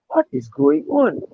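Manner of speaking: high, drawn-out disbelief; slow, high-pitched bewilderment; stunned. Retold or imitated. Retells own stunned reaction.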